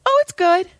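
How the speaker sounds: high-pitched mock breezy reply; teen feigning fine. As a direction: imitate a kid glossing things over. Son lies to therapist.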